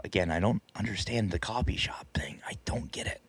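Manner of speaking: under-the-breath whisper; whispered aside. Real, not acted. Muttered aside about coffee shops.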